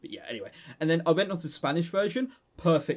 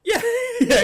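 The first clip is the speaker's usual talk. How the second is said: laughing yeah; 8 dB louder than usual. Real, not acted. Laughing agreement.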